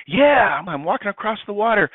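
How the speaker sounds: high, loud, giddy exclamation; acting out an eager, thrilled disciple; excited boast. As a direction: crow it with overexcited bravado. Acts out Peter's bold step onto water.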